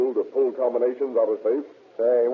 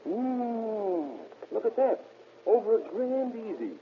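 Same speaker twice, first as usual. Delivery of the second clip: impressed; 9 semitones higher, 6 dB quieter, much slower than usual. Acted. Safecracker gloating over loot.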